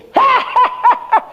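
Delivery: high staccato demonic cackle; possessed laugh. Retold or imitated. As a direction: cackle suddenly like a possessed man. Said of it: "Tiba-tiba dia gini". (tawa setan melengking terputus-putus)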